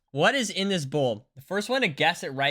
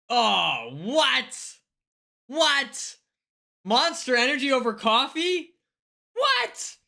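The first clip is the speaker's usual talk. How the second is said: high-pitched disbelieving what; incredulous; 8 semitones higher, 3 dB louder, slower than usual. Real, not acted. Reacting to a chatter who drinks Monster, incredulous. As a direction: squeak out disbelief, louder.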